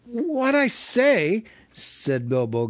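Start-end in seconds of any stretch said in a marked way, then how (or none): 0.0-1.4 high, slow breathless reply; gasping hobbit. A narrator's reading. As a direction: gasp it out in fright.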